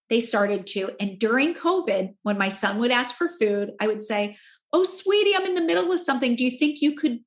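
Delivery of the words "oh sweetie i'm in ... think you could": high, sweet, hesitant mom voice; tentative question. Retold or imitated. Mom to son asking for food, tentative and sweet. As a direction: float it gingerly as a higher-pitched request. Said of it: "I'd ask it as a question".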